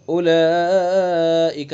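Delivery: "اولئک" with vowel held long; slow model of the long madd. Shown as a demonstration. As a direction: stretch "اولئک" slowly. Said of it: "مد کریں گے".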